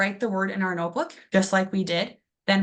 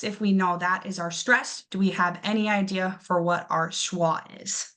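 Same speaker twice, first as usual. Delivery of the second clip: ordinary delivery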